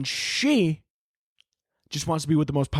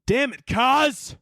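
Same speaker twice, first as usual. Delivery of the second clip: slow, loud, higher "Damn it, Kaz!"; exasperated exclamation; 8 semitones higher, 4 dB louder, much slower than usual. Shown as a demonstration. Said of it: "That's how it starts".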